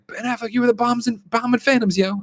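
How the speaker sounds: very high, excitable character voice; Jay. Retold or imitated. Stoner running past the star, high mock cheer. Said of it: "but he's like".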